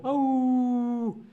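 high, sustained imitated wolf howl. Shown as a demonstration. Teacher illustrating wolves for learners, high howl.

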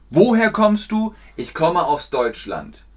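usual speaking voice

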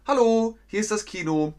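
bright high phone greeting as the cinema; a chirpy cinema employee picking up; cinema clerk voice. Acted. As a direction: answer the phone as cheery staff. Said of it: "Dann sagt das Kino".

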